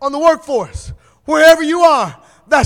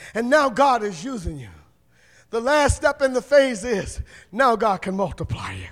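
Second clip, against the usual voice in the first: soft, hushed preaching; 6 semitones lower, 9 dB quieter than usual. Acted. Preacher gently recapping over soft music. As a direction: ease the church into stillness.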